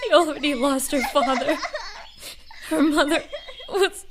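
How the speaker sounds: grief spilling over as she speaks of her family; tearful breakdown. Acted. Sister grieving at funeral.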